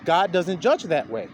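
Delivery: ordinary talk, nothing marked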